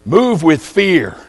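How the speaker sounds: very slow, each word weighed; slow solemn title. Acted. Preacher opening his sermon, stating the theme slowly. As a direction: let the title sink in slowly.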